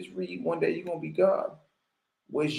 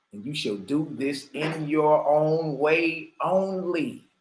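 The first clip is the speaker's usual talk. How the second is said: slow emphatic repeat; slower than usual. Shown as a demonstration.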